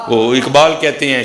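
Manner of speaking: normal talking voice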